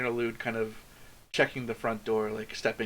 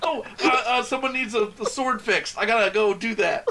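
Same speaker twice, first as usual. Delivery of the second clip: laughing mock excuse; 9 semitones higher, 5 dB louder than usual. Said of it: "voicing Trevor leaving, laughing".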